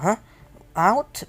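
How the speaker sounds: sudden high, loud "Out?" in disbelief; surprised. Acted. Girl startled when the boy asks her out, surprised. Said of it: "And Tsuki's like".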